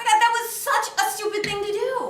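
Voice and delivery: high, loud reproach; loud, worked-up outburst; exasperated scolding. Acted. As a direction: lay into him for his stupidity. Scolding partner in staged quarrel.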